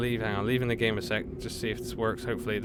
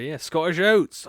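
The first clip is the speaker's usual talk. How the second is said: loud, slow, high Scottish joke; mock Scottish exclamation; 7 semitones higher, 11 dB louder, slower than usual. Joking about Scots and porridge.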